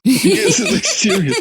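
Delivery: high, loud laughing words. Real, not acted. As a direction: speak through laughter. Laughing while talking.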